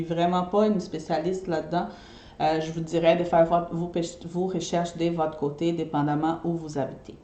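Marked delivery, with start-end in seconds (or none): none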